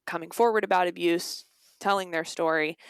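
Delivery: usual speaking voice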